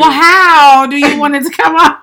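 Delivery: high, loud, exasperated question; exasperated retort. Retold or imitated. To a boyfriend fumbling his words, loud exasperated demand. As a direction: demand he just say it.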